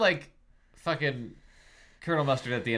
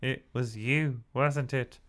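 suspicious; 2 semitones higher than usual. Acted. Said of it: "eyes narrowed in suspicion".

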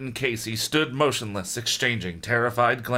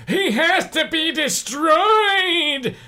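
very slow, high shout; 3 dB louder, much slower than usual. Acted. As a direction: roar for his destruction.

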